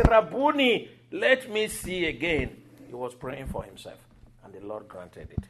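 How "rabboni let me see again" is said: high, raised, deliberate plea; clear retold plea. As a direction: call it out clearly, higher and louder. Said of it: "But it was so clear".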